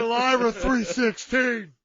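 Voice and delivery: loud, slow, drawn-out proclamation; mock promo catchphrase. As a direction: boom it out like a wrestling catchphrase. Parodies Stone Cold catchphrase.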